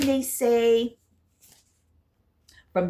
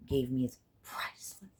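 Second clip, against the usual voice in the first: whisper; 6 semitones lower, 7 dB quieter than usual. Real, not acted. Moved, hushed.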